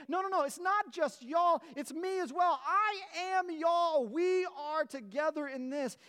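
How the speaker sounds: rising passionate preaching; fervent proclamation. Retold or imitated. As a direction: preach it as Paul, caught up in the moment. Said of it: "proclaiming and he feels".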